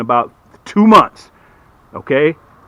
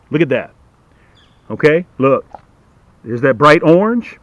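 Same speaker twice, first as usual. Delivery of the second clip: ordinary talk, nothing marked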